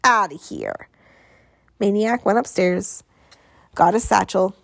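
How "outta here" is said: put-on low, scornful kid dismissal; jeering send-off, pitched down; sneering. A narrator's reading. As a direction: sneer them out of the house. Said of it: "sneered Piper".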